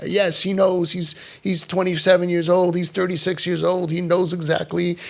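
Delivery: insistent parent. Retold or imitated.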